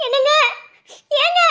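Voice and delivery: short loud hails to someone indoors; calling out. Acted. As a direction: keep calling for someone to come out. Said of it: "கூப்பிட்டு பாரு". (வீட்டுக்காரரை உரக்கக் கூப்பிடுதல்)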